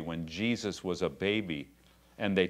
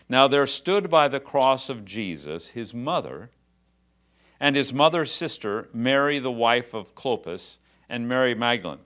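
raised, measured reading of the Bible passage; scripture reading; 3 semitones higher, 5 dB louder than usual. Acted. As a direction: read the verses out to the congregation. Reads scripture aloud.

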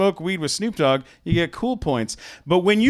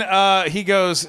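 ordinary delivery

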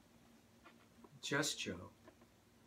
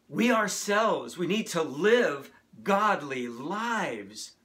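rally the congregation, building in force; impassioned preaching; 3 semitones higher, 6 dB louder than usual. Real, not acted.

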